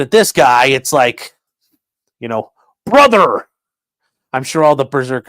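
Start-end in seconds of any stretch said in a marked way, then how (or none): 2.2-3.5 mock bro greeting.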